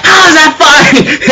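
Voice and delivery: high, slow, laughing disbelief; incredulous laugh. Retold or imitated. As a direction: squeal it out in mock shock. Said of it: "He goes like".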